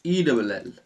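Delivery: ordinary delivery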